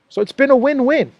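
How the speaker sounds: high mock voice for "win-win"; mocking Blinken. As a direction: parody a smug official.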